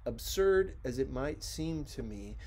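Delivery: plain reading voice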